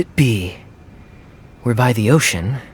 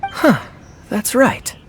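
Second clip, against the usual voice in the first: sudden realization; 11 semitones higher, slower than usual. Acted. Sudden realization about the clue.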